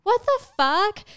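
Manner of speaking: high stunned what the fuck; shocked. Retold or imitated. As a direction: blurt it out in disbelief. Shocked at [name] license plate.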